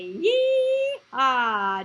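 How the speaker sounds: loud cowboy yee-haw; cowboy cheer. Shown as a demonstration. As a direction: call it out like a cowboy. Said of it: "here's our cowboy cheer".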